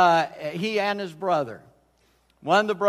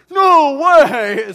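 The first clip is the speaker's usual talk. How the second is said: mock-excited shout, much higher and louder; the son's thrilled outburst, much higher and louder; 10 semitones higher, 16 dB louder than usual. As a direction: blurt it out as the thrilled son. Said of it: "So he was all excited".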